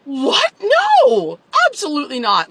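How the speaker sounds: high, loud, incredulous no; outraged disbelief. Retold or imitated. Reacting to boyfriend's excuse with loud disbelief. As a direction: recoil in shocked refusal.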